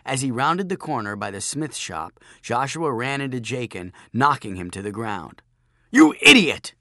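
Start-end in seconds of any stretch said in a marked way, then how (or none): 5.9-6.7 loud, high, furious outburst; angry shout. Acted. Bully knocked down by Joshua, angry shout. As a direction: shout it at full force in sudden rage. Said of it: "Jachin shouted".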